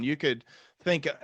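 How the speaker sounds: ordinary talk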